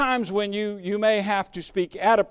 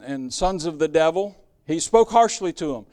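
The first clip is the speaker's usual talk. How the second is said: ordinary talk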